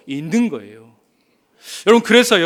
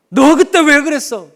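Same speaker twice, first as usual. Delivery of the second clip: high, loud rebuke; mock scolding; 6 semitones higher, 11 dB louder than usual. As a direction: snap it like an angry reproach. Imagined Jesus scolding Peter. (높고 크게 꾸짖듯)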